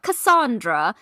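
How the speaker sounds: put-on British-accented "Cassandra", the middle vowel a broad "ah". Podcast host imitating a castmate introducing someone, British accent. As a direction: say "Cassandra" the British way, as "Ca-SAHN-dra". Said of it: "Chelsea has a British accent".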